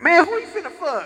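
high, squeaky-pitched heckle; high mock challenge; jeering onlooker. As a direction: imitate a heckler from the crowd.

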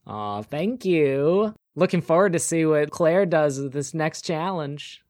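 high, sweet busybody neighbor voice; sugary HOA lady. Acted. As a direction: purr it like a pushy neighbor. Glenda voice again.

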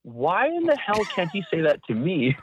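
a hint of frustrated thought; mock exasperation. Cameraman wondering why his boss won't praise him, lightly exasperated. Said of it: "So I'd be sitting there thinking".

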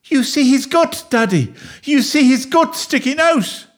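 high-pitched, panicky wail; hysterical child. Acted. Boy showing daddy the injured dog, hysterical and high. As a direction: wail it high, desperate to be believed.

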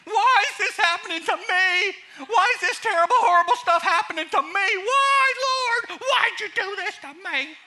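very high, loud, wailing lament with laughter; hammed-up cry to the Lord; mock wailing. Pastor parodying a self-pitying man crying out to God.

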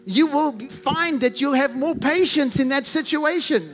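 ordinary delivery

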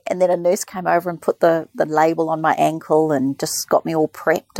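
ordinary delivery

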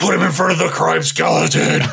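lightly voiced gravel-free Batman; a hint of the Dark Knight. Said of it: "That's my best Batman".

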